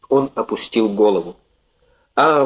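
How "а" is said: ordinary delivery, nothing marked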